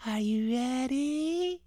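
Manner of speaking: high, soft, slow ghostly moan; spooky ghost voice. Acted.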